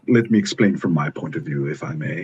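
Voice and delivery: put-on British accent, sophisticated and measured. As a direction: explain it politely in a mock British accent. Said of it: "Get rid of that British accent".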